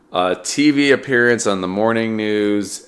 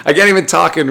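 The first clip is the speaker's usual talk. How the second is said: laughing; 8 semitones higher, 4 dB louder, faster than usual. Real, not acted.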